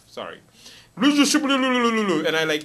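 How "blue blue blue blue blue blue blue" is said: high, loud French patois gibberish; mock French patois accent. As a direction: rattle off badly faked French patois babble. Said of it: "French patois accent".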